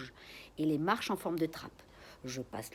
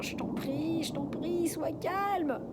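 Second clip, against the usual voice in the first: much higher, anxious begging; pleading; 11 semitones higher, slower than usual. A narrator's reading. Silently begging parrot to hush.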